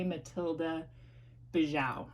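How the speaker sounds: "Bijou" said the Midwest way, ending like "bee-zhow"; Midwest accent. Shown as a demonstration. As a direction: Midwest accent, "Matilda Bijou" read as spelled. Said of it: "if I was just gonna say it in my Midwest accent".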